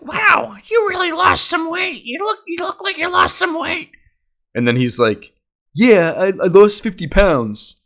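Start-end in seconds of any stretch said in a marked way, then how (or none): 0.0-3.9 very high-pitched mock grandma voice; put-on high voice of an impressed grandma; grandma imitation. Grandma tells cousin about weight, high mock-impressed voice. 5.7-7.8 higher, louder put-on voice; cousin imitation. Cousin answers grandma about weight, high put-on voice.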